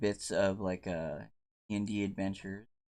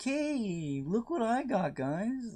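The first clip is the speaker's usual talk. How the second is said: excited friend; 12 semitones higher, slower than usual. Retold or imitated.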